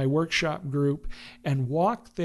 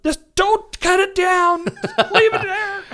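laughing plea; 5 dB louder than usual. Retold or imitated. Delighted stranger asking to keep lights, high laughing. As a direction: beg through laughter to keep it. Photographer begging lights stay.

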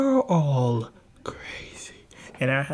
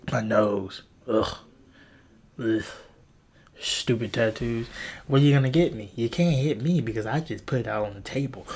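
ordinary delivery

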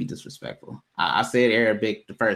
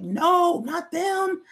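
high panicked squeak; much higher, frantic mock plea; panicked inner voice; much slower than usual. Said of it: "I'm like".

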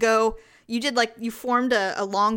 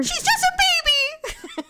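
mimicking mom; slower than usual. Mom scolding retold, high laughing.

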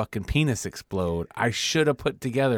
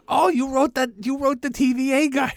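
much higher, louder gushing fanboy voice; starstruck fanboy; 5 dB louder than usual. Retold or imitated. Recapping Loki, mimicking a starstruck fan meeting his idol, high gushing squeal.

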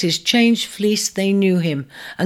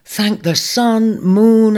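slow, louder thanks with relief; relieved; 5 semitones higher, 6 dB louder, slower than usual. A narrator's reading. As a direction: sigh of gratitude to the heavens. Ares relieved to see rams.

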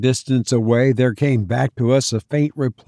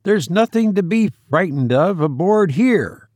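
high-pitched reassuring call; exclaiming; 6 semitones higher than usual. A narrator's reading. Will reassures the stranger in the boat, exclaiming. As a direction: call out reassurance across water. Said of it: "exclaimed Will".

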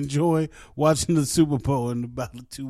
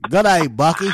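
hammed-up Aussie greeting; Australian accent; 4 dB louder than usual. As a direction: attempt a broad Australian accent. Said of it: "I can't do the Australian accent".

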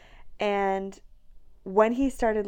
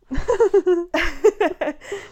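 loud laugh; 9 semitones higher, 11 dB louder than usual. Real, not acted. Laughing at own story.

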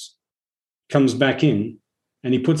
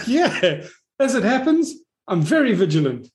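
smug self-praise; 9 semitones higher than usual. Retold or imitated. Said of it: "And then you go".

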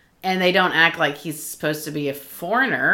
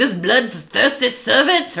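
mock grand villain; 4 semitones higher, slower than usual. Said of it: "'cause he's like".

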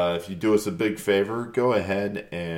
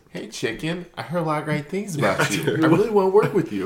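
higher, rising bad Wahlberg impression; Mark Wahlberg impression; 8 semitones higher, 4 dB louder than usual. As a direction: do a terrible Wahlberg. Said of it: "We're gonna do terrible Mark Wahlberg impersons".

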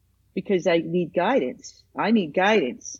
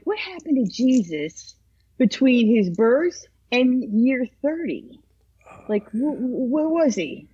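puzzled, higher-pitched wondering; curious; 5 semitones higher than usual. Retold or imitated. Guest recalling a Bible puzzle, openly curious. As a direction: retell your own burning question, stumbling into it. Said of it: "I always was curious".

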